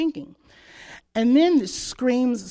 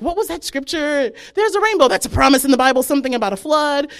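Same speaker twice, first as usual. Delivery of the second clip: higher, louder mock panic; frantic; 5 semitones higher, 7 dB louder than usual. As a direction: scramble desperately to remember. Frantically recalling scripture, retold.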